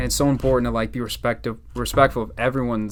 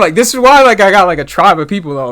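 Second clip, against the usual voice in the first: much higher and louder, fired up; hyped; 8 semitones higher, 11 dB louder than usual. Real, not acted. Rapper hypes his crew to the host, loud and high. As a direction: hype yourself up to your friend.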